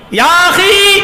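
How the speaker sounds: high, loud call to a man walking ahead; high, loud call from behind to a stranger. Acted. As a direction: call after him from a distance. Umar calls out from behind.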